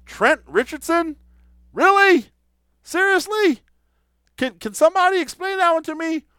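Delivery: high, loud disbelief; can't believe this signing, really?; incredulous. Real, not acted.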